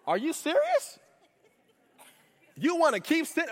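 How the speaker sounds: high, disbelieving comic outrage; incredulous Cat Williams. Retold or imitated. Paul stares down the Roman believers, high incredulous disbelief. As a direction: act baffled like a comedian who can't believe it. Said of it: "He gives him the Cat Williams face".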